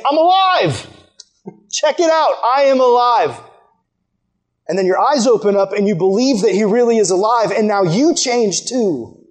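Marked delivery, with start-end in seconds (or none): 0.0-3.4 high-pitched, jubilant announcement as Jesus; triumphant proclamation. Acted. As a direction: proclaim it with rising excitement. Voices Jesus announcing he is alive.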